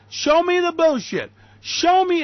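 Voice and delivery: high, forceful, drawn-out challenge; angry demand. Real, not acted. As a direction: demand proof with a rising, ringing voice. Angrily demanding proof.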